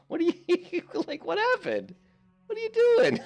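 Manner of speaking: very high, squeaky shriek with laughter; hammed-up self-scolding yell. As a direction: squeal at yourself in mock disbelief. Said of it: "I just was yelling at myself".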